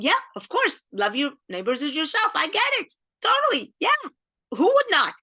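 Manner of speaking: high, casual, offhand agreement; mock your old careless self, lightly; breezy self-mockery. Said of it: "I was thinking".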